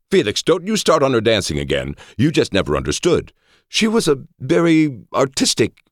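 very high, affronted protest; high-pitched, hammed-up offence; indignant friend, defending his lover. A narrator's reading. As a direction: defend her honour, pitch climbing. Ali defends Labella indignantly.

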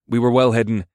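plain reading voice

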